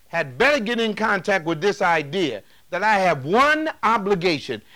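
ordinary delivery

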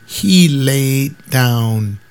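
slow "he laid down". Retold or imitated. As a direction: drag out "he laid down". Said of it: "I don't get the "he laid down".

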